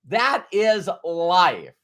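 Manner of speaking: slow and deliberate, each word weighted; slow emphasis. Real, not acted. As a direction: land it slowly like a lesson. Driving home the point.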